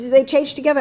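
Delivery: put-on light British accent, high and loud; mock British surprise; hint of British accent. Young woman asking the speaker, in a light British accent. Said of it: "She said".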